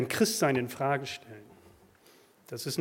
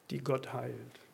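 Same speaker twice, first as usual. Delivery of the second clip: ordinary delivery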